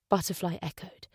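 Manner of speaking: ordinary delivery, nothing marked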